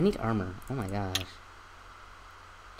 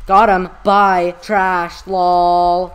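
loud, high taunts in short bursts; triumphant trash-talk yell; gloating shout; 3 semitones higher, 11 dB louder, much slower than usual. Real, not acted. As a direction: crow over a beaten rival. Gloating over a kill.

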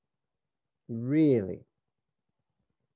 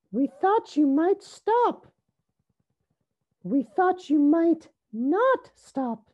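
high, loud character voice; little sisters' voices; 6 dB louder than usual. A narrator's reading. Sisters tell Rosie their worry, high loud little voices. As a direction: squeal it high, drawing out the end. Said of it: "they said".

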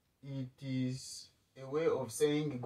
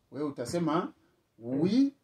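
ordinary talk

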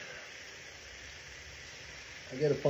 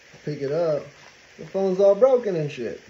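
ordinary talk

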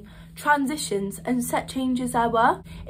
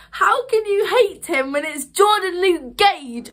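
gushing; 6 semitones higher, 4 dB louder than usual. Retold or imitated. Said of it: "I was like".

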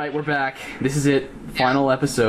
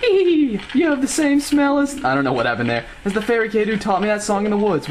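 squeaky character voice; 7 semitones higher than usual. Pretend. Voicing Skull Kid.

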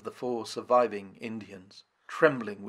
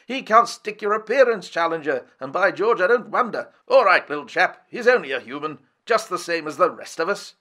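hearty mocking banter, louder; teasing lord; 5 semitones higher, 6 dB louder than usual. A narrator's reading. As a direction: rib him jovially.

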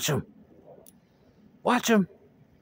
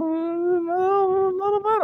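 squeaky teasing understatement; 13 semitones higher than usual. Pretend. Mockingly minimising.

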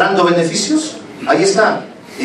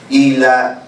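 ordinary delivery

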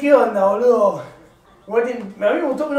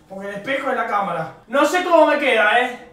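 usual speaking voice

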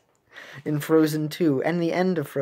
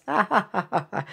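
raised, exaggerated pun laugh; hammy chuckle; put-on laugh; 4 semitones higher than usual. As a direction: laugh at your own bad joke. Laughs at own ice pun.

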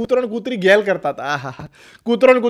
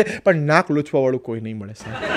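lower, softer, through a laugh; laughing punchline; 9 semitones lower, 8 dB quieter than usual. Real, not acted. Comedian to viewers, lower laughing punchline. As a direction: chuckle out the punchline.

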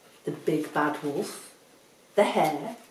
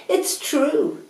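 boastful; 10 semitones higher, 6 dB louder than usual. Acted. Boastful hare agrees with praise.

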